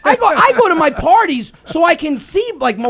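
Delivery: ordinary talk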